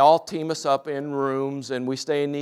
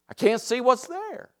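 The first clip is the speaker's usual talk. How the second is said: put-on child's voice; 10 semitones higher than usual. Kid's answer voiced in story.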